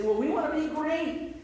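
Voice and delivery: high, mock-whiny want to be great; a touch of petulant whining; whiny disciples. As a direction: pitch it up like a greedy child. Disciples mocked in retelling.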